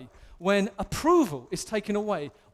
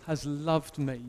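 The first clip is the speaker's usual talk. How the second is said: tender Jesus; 6 semitones lower, 4 dB quieter than usual. Acted. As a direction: speak it gently, like a loving promise. Jesus speaks after washing feet.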